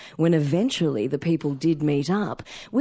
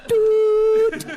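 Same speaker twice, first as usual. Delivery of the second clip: imitated machine beep; high, loud beep sound; mock voicemail tone; 5 dB louder than usual.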